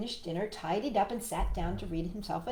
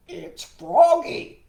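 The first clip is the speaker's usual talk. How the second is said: slow, loud croak at the door; croaking frog; 7 semitones higher, 12 dB louder, much slower than usual. Acted. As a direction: announce yourself as a big frog. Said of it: "croaked the same deep voice".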